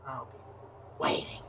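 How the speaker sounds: low breathless menacing whisper; whispered threat. Acted.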